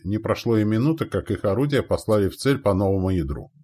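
ordinary delivery

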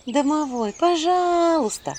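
slow drawn-out begging; pleading. Acted. Heroine pleading with the house spirit. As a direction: beg him gently. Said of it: "взмолилась я".

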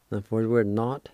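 ordinary talk

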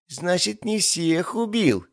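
high, drawn-out crow of victory; triumphant. Acted. Demon catches his master in a lie, triumphant. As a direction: crow it like an accusation. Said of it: "торжествующе заявил Кебаб".

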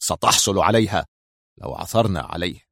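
understated impatience, dropping low; annoyed. A narrator's reading. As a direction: answer with restrained annoyance. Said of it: "أجابه في ضيق".

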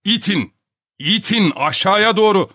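high, urgent, repeated command; shouted instruction. Acted. Professor keeps shouting directions through door. (ısrarla, tiz sesle bağırarak)

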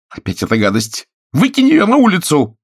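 irritated, then suddenly high and loud; breaking into shout. A narrator's reading. Actress orders the cat thrown out, breaking into a shout. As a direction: snap upward into a furious cry. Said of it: "Внезапно голос её сорвался на крик".

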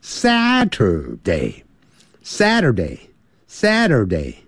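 Saturday slowly, like 杀头day; slow repeated Saturday; slow Saturday drill. Shown as a demonstration. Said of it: "你就这样记".

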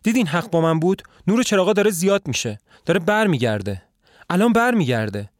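panicked, push him out before her husband comes; fear and agitation. A narrator's reading. The wife urging the visitor to flee, high frightened whisper-turned-plea. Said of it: "با ترس و هیجان میگه".

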